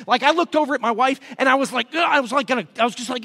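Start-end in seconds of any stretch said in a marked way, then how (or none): 1.9-2.0 acted-out exasperated groan; short mock growl of frustration; frustrated grunt. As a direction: grunt as if at breaking point. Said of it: "I was so frustrated".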